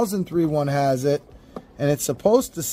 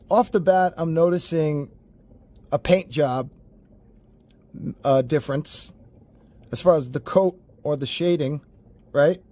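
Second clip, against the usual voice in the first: ordinary talk, nothing marked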